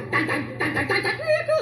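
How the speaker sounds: laughing through a hummed horror score; light mock horror soundtrack; horror music hum. Shown as a demonstration. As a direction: hum the suspense music, giggling. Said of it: "that music they always have in horror films".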